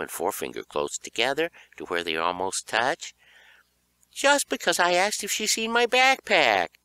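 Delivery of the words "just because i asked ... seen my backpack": whiny protest. Acted. Boy complaining to mom.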